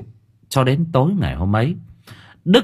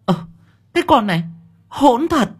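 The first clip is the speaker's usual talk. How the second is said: shocked elder; 13 semitones higher than usual. A narrator's reading. Elder shocked at daughter-in-law.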